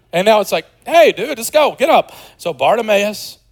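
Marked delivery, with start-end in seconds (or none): 0.8-2.1 mock crowd. Crowd voiced eagerly.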